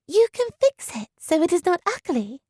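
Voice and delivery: put-on light, high girlish voice; bright young girl's plea. A narrator's reading. Georgiana voiced by narrator.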